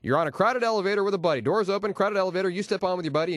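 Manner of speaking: usual speaking voice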